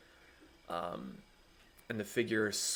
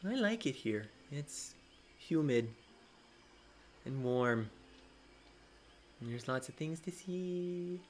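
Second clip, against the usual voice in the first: gentle dinosaur voice; 5 semitones higher, 3 dB quieter than usual. Acted.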